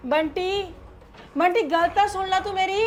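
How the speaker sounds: high, loud plea after him; anguished mother crying out to her son; desperate calling out. Acted. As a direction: call him back before he goes.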